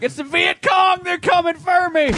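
mock panicked high shout; freaking out. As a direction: yell it like a hammed-up alarm under attack. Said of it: "I freak out and be like".

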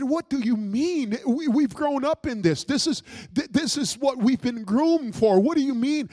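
loud, high retold protest; agitated protest. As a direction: reenact an incredulous, heated objection. Retold argument over the calling.

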